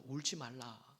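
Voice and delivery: soft, low and gentle; tender comfort. Acted. Jesus comforting the weeping widow, soft and tender. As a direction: hush her tears tenderly.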